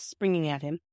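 plain reading voice

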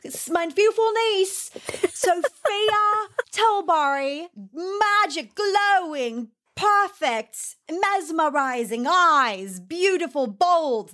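posh British gush. Retold or imitated. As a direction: lay on the plummy British charm, gushing adjectives. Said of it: "That was so good, [name]".

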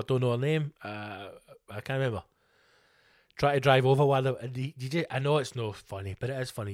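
ordinary talk, nothing marked

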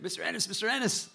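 a doctor hurrying out, calling the patient's name; doctor rushing from the back, calling the patient urgently. Retold or imitated.

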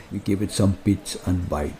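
put-on a deep comic Russian accent. Joking with her co-writer about cake, mock Russian accent. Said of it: "Russian accent".

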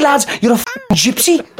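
put-on Scouse accent. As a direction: repeat the insult in put-on Scouse. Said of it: "impression of the man getting out of the car".

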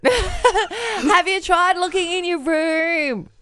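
slow high call, laughing; mother calling out. Pretend. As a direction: call slowly from another room. Mother calling back, slowly.

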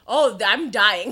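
half-laughing retold panic; retold alarm. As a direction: play up the doom with a laugh. Acts out paralysed panic, laughing.

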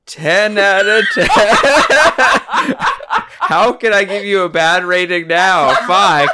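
high, loud laughing reply; laughing helplessly at a crying friend. Real, not acted. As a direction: give in through laughter. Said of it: "I don't know why I got so emotional".

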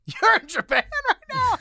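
the agent's laughing disbelief on the phone; amazed, laughing question; laughing surprise. Retold or imitated. Agent laughs, stunned at news.

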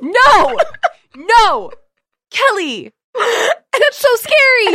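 high, loud horrified cries; horrified shriek. Real, not acted. Friend hears the prank story and shrieks in horror. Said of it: "yeah seriously".

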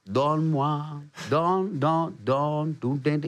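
slow laughing Donne-moi, then donne, donne; slow repeated line. Retold or imitated. Musician searching the melody, slow laughing chant. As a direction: repeat the hook, trailing off. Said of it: "Il le dit quatre-vingt-cinq fois".

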